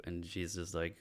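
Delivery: ordinary talk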